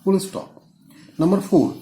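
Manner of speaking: ordinary talk, nothing marked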